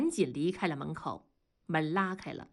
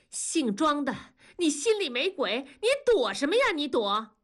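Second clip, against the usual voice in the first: rising higher into an angry shout; confronting anger; 7 semitones higher than usual. A narrator's reading. Meimei challenges Dazhuang in front of everyone, angry shout.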